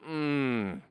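wordless, heavy hum of distress; troubled groan. Retold or imitated. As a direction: groan a long "mm" in imitation of a troubled man. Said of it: "Bible says he groaned".

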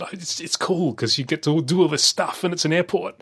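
put-on excited child. Quoting young son's excited answer.

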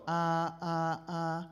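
each "ah" held even, no glide; three steady "ah"s on one pitch; level tone. Shown as a demonstration. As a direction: keep "ah" level from start to end. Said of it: "is to go level".

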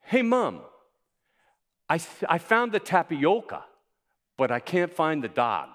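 high, loud Midwestern voice on "tapioca"; Midwestern accent. Shown as a demonstration. Preacher mimics a Midwesterner asking about the dog. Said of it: "Midwesterners should know this".

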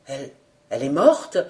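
low, halting disbelief; stammering question. A narrator's reading. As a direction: falter on the first word, then ask. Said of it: "bégaya le journaliste".

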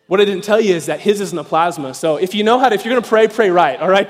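ordinary talk, nothing marked

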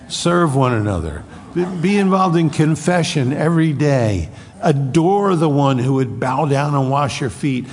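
rally the congregation to the finish; preaching. Acted. Pastor urging the church to serve and confess, fervent preaching. Closing exhortation.

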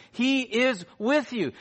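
"He is with you" slow and high, each word weighted; emphatic repetition. Real, not acted. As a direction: drive home "He is with you" word by word, slowly. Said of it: "Let me say it one more time".

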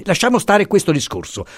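high, sharp shutting the topic down; impetuous refusal. A narrator's reading. Professor refusing to discuss the Rigée, impetuous. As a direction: end this conversation now. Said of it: "diss'egli impetuosamente".

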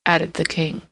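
usual speaking voice

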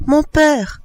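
put-on high-pitched exclamation of a child; child's tearful cry. A narrator's reading. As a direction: call out to father, high and pleading. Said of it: "s'écria l'enfant en s'avançant, les larmes aux yeux". (cri d'enfant éploré)

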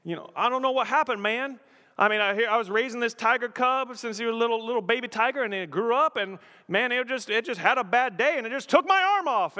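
high, rushed mock tiger owner; Tiger King owner. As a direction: play a baffled tiger keeper telling his story. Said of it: "Tiger King, anyone?".

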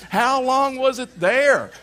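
loud, high, drawn-out demand; mock exasperation. Husband grilling wife about the soaked phone, mock exasperation. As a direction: stretch it out, hammed-up and loud.

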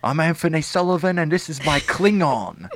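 high-pitched mock infomercial pitchman; Anthony Sullivan. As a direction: sell it like a TV product demo. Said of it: "sounds like an infomercial kind of product".

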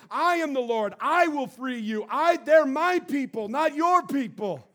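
loud proclaiming scripture voice; preaching. Acted. Pastor reading God's words to Moses, proclaiming loudly.

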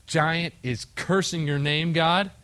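David pleading to fight, fast and fired up; eager plea. Retold or imitated. David begging Saul to fight.

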